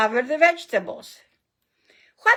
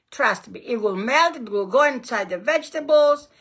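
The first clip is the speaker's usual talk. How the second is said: ordinary delivery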